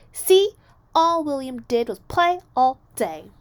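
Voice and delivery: loud, high indignant cry; indignant accusation. A narrator's reading. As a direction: stomp and accuse loudly. Jamaica stomps, accuses.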